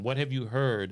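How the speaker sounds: ordinary delivery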